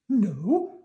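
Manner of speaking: high, slow sweet worm voice; country cousin worm, polite. Acted. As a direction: ask very politely, pitched high.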